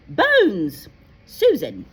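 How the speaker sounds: loud roll call; calling out a name. Acted. McGonagall calls the next student up, loudly. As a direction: call the name across the hall.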